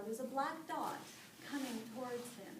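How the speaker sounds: ordinary delivery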